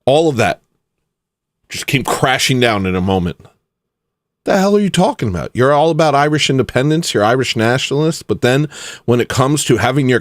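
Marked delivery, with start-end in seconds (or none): 4.4-5.5 exasperated. Real, not acted. Ranting at band.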